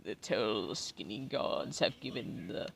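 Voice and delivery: hammed-up hungry creature pleading; begging animal voice. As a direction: beg for oats like a silly starving creature. Voicing an animal begging for oats.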